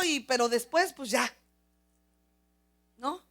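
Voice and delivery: normal talking voice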